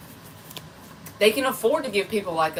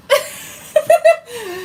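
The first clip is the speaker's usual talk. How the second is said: loud laugh; 7 dB louder than usual. Real, not acted. Bursts out laughing at own joke.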